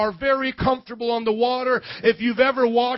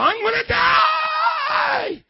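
hammed-up high panicked wail; freaking out; much slower than usual. Preacher voicing a believer freaking out over life, mock panicked scream. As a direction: shriek it, stretching the last word out. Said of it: "You may be freaking out right now".

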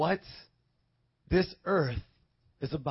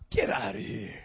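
mock disbelief; 9 semitones lower, 4 dB quieter than usual.

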